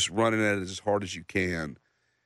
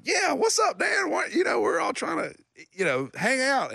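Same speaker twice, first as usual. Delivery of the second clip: imitating Reed. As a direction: rib him like a pushy friend. Reed voiced in story.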